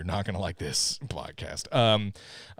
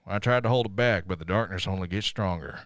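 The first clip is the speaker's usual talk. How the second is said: ordinary talk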